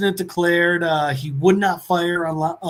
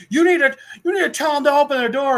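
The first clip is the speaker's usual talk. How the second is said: high, fast pushy neighbor; pushy demand; 9 semitones higher, 4 dB louder, faster than usual. Retold or imitated. Neighbor demands the father get the door opened: high, fast pushy voice. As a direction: rush the demand at him.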